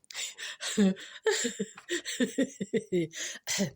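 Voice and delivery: long, breaking-up chuckle; laughing. Real, not acted. Storyteller after the Jesus pancake punchline, laughing. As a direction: giggle away at your own punchline.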